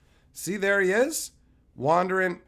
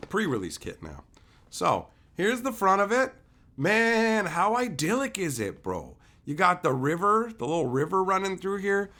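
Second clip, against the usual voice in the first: ordinary delivery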